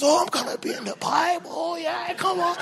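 high, fast, excited mock cheer; happy ram. Ram celebrating to himself, high and excited. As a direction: gush like you just got picked. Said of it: "And he's all happy".

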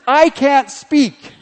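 imitating a flustered Moses; stammering Moses. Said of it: "That's maybe the way he said it".

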